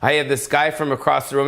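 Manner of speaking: usual speaking voice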